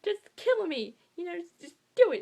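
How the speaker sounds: high, loud, desperate plea; hammed-up frantic begging; frantic Dorian. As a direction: plead wildly, pitch and volume up. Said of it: "Dorian went insane".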